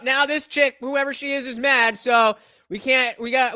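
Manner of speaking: ordinary talk, nothing marked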